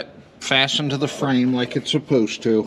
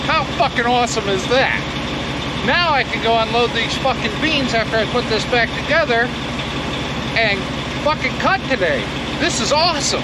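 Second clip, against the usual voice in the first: thrilled; 9 semitones higher than usual. Real, not acted. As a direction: burst out in real delight. Repair worked, overjoyed.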